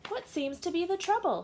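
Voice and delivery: a brisk doctor asking; Doctor Ram voice. Acted. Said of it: "he asked".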